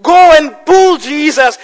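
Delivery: loud, high, emphatic sermon delivery; full-force preaching. Real, not acted. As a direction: proclaim it forcefully to the congregation.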